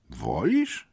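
understated amazement, rising at the end; astonished question. A narrator's reading. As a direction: ask in quiet disbelief. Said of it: "zdumiała się dyrektorka". (zdziwione pytanie dyrektorki)

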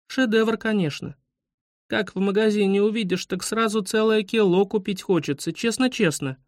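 put-on high, childlike earnest reassurance; kind soothing. A narrator's reading. Kind schoolgirl calms the upset guide, soothing. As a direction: agree sweetly as a little girl. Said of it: "успокоила экскурсовода добрая Таня Смирнова".

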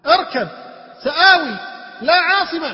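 short high, loud bursts with pauses; urgent preaching. Acted. Preacher replaying the last exchange of father and son, urgent. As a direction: fire off each cry urgently.

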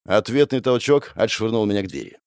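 usual speaking voice

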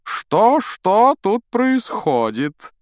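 high, loud, drawn-out question; grand theatrical inquiry. A narrator's reading. Vain teacher bursts in on the pupils, grandly theatrical. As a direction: sweep in with a showman's flourish.

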